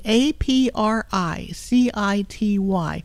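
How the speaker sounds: each letter slow and clear; slow spelling. Shown as a demonstration. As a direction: spell it out slowly. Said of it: "spelling it out".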